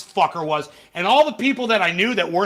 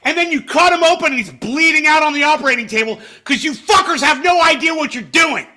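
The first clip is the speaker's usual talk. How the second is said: angry rant; 6 semitones higher, 5 dB louder than usual. Real, not acted. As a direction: yell the correction back at the screen. Angrily correcting Vance clip.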